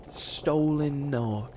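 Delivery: normal talking voice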